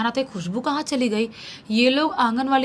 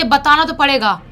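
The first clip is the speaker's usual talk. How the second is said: loud, higher, stern threat; threatening exorcist; 4 semitones higher, 7 dB louder than usual. Acted. Exorcist threatens the spirit to make it talk, loud stern voice. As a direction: lay down the ultimatum forcefully.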